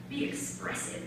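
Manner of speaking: breath-only poetic instruction; hushed slam-poem refrain; whispered command. Acted. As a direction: drop to a whisper. Poem command, later repeated louder.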